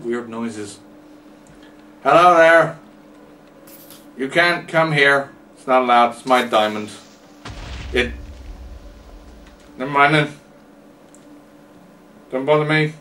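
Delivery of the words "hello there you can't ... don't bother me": possessive warning. Acted. Guarding his diamond.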